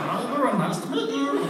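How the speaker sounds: high silly creature greeting; chicken monster voice. Retold or imitated. Actor greeting crew in chicken monster voice. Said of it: "using the voice like an idiot".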